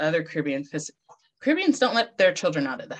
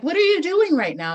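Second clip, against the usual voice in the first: higher, louder, demanding; the healer demanding an answer, high and loud; pointed question; 11 semitones higher, 6 dB louder than usual. Retold or imitated. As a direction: fire the question at her. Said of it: "and she's like".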